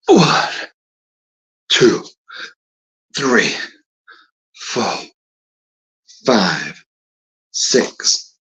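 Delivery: counting reps while exercising; strained counting between push ups; doing push ups. Real, not acted. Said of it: "Now I'm gonna do ten more".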